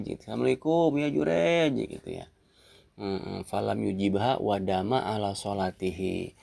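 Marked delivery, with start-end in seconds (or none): 0.2-1.8 higher, sing-out call on the name; mother calling out. Acted. Mother visiting her praying son, calling out to him. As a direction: call the greeting out, lifting the name high.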